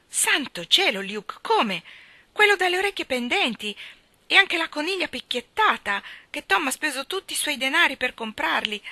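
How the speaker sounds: shocked exclamation. Acted. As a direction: cry out in disbelief over the dead rabbits. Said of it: "esclamò Maggie". (Maggie sconvolta, grida incredula, voce molto più acuta)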